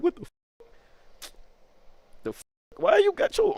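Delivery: high, unvoiced, startled "what the f-"; startled whisper. Boy feels an arm around his shoulder, startled high whisper.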